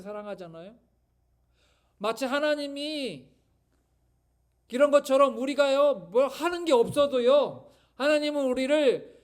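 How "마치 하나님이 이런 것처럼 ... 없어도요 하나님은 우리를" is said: rising to a loud preaching peak, higher; building sermon. Acted. Pastor assuring the congregation of God's love, building to loud preaching.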